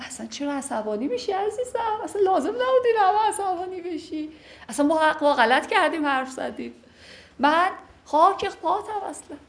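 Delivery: high, coaxing plea to calm him; coaxing wife. Acted. Teacher plays the wife coaxing her angry husband, high and pleading. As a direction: sweet-talk the angry husband.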